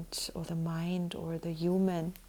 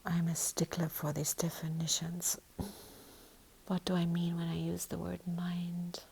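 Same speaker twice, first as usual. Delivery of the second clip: soft breathy whisper throughout; 5 dB quieter than usual. Real, not acted. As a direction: whisper each thought gently.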